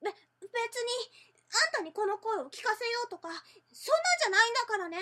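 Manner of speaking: stammer, then huff it out defensively; tsundere. Shown as a demonstration. Classic tsundere denial line.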